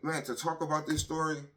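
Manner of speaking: ordinary talk, nothing marked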